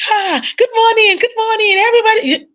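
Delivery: high, sing-out church hello; put-on smiling greeting. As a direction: greet everyone with a big put-on smile. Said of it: "we are smiling".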